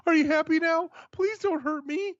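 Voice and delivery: high, scared pleading mock voice; pleading Triple H. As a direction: beg him like a frightened boss. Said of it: "phone call ... and go".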